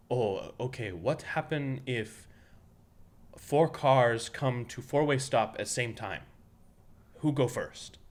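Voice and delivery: a driving teacher asks the class in a lightly put-on Korean accent; Coach Kawa's Korean accent. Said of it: "doing an impression of Coach Kawa exactly".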